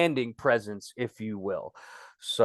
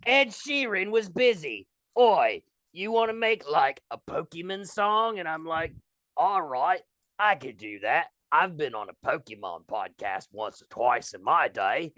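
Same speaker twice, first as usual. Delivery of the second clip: hammed-up, muddled British accent; 3 semitones higher than usual. Guest recounting the Pokemon Company's request, mock British accent. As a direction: tell the anecdote in bad fake Cockney. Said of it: "British or Australian or what are you".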